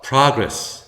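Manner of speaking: progress stressed first, "PRAH-gress"; front stress. Shown as a demonstration. Teacher modelling the noun form, progress stressed as PRAH-gress. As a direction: punch the open first syllable of progress. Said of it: "아로 발음하니까".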